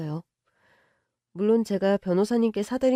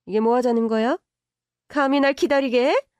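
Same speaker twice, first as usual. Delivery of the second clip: higher and louder, furious; angry outburst; 3 semitones higher, 4 dB louder than usual. Acted. Mother snapping at the girlfriend for being late, angry. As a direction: blow up at her for the wait. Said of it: "버럭 화부터 내셨습니다".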